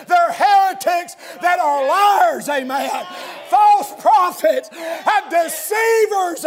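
shouted pulpit denunciation; fiery preaching. Real, not acted. Preacher denouncing false prophets to congregation, fiery shouting. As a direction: ring out the accusations, drawing out the key words.

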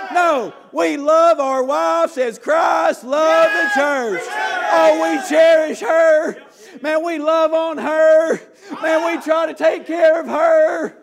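loud, high preacher's exhortation; full-force revival sermon; fiery preaching. Acted. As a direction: preach it hard to the congregation.

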